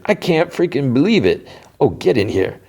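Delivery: high-pitched, mock-excited welcome; delighted greeting. Retold delighted greeting at the door.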